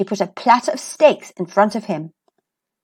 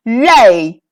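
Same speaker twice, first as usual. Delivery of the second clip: loud barked order; shouted command; 5 dB louder than usual. A narrator's reading. Giant ordering his hen: loud shouted command. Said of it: "and shouted".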